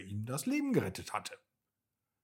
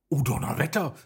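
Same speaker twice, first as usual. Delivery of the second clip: louder, higher storybook exclamation; calling out; 4 semitones higher, 6 dB louder, much faster than usual. A narrator's reading. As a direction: cry out as the dragon spotting a chance. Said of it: "rief Fritzi". (lauter, erzählter Ausruf)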